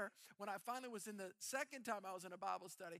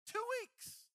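high, squeaky disbelief; amazed; 5 dB louder than usual. Real, not acted. Pastor to congregation, amazed and pitched way up. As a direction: marvel out loud at how quick it was. Marvelling at two weeks.

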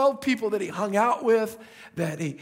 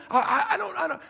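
laughing stammered denial; 10 semitones higher, much faster than usual. Retold or imitated.

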